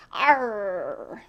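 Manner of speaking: a short gruff pirate arr; quick playful pirate shout; pirate growl. Acted. Pirate tale comes to life.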